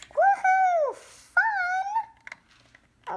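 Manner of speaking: high, loud happy whoop; excited whoop. Pretend. Kid trying the toy microphone, high excited whoop. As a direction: whoop with glee.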